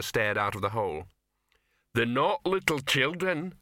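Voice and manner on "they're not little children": slow, higher troll voice, lightly grumbled; muttering troll. A narrator's reading. Troll complaining about the eating ban, mild mutter. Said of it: "muttered the one who had been complaining".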